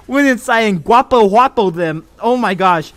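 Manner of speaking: laughing, high and loud hype; genuine excitement. Real, not acted. Streamer recounts a wild team fight, genuinely excited. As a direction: yell the play-by-play in real amazement. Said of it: "that was genuine excitement".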